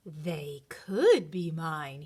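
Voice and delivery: low, slow, scheming under the breath; greedy bunny hatching a wicked plan; sly scheming. A narrator's reading. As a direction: plot it slyly, savoring each word. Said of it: "with a wicked idea".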